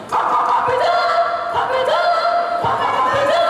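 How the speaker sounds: very high squawking clucks of a hen; clucking hen. Acted. Hen screaming at her hatched chick, shrill clucking. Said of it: "dia teriak-teriak".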